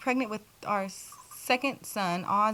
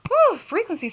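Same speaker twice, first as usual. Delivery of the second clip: excited whew; 11 semitones higher, 7 dB louder than usual. Real, not acted. Exclaims about frequencies.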